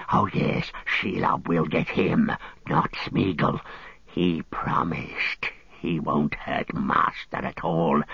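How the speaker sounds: low, hissing creature, gloating; Gollum. A narrator's reading. Gollum gloating in Sam's ear as he grips him, hissing. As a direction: hiss it in a whispery, gloating creature voice. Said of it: "hissed Gollum in his ear".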